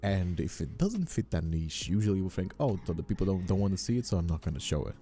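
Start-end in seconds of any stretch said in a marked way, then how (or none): none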